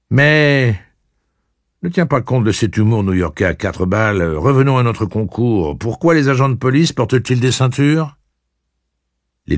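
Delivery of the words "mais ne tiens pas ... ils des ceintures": cocky taunting. Acted. Eddie pressing Blaine.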